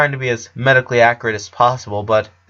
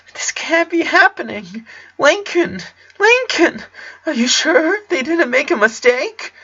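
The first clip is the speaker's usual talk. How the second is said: put-on very high desperate boy voice; in denial, begging it's a mistake; high frantic pleading; panicking Clyde. A narrator's reading.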